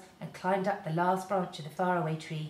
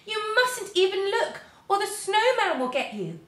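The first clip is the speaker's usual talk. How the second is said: frightened warning; 13 semitones higher, 8 dB louder than usual. A narrator's reading. Moonface warning Jo about the snowman, frightened. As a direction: warn him in fright. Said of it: "in his fright".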